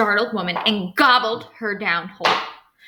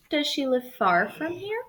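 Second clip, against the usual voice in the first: sly wolf asking; 7 semitones higher than usual. Acted. Wolf asks girl.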